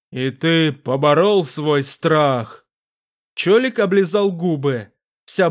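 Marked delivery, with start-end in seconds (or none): 0.1-2.7 a vast presence speaking from the dark; booming demon voice. Acted.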